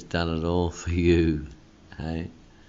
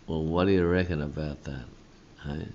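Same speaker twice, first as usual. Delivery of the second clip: ordinary talk, nothing marked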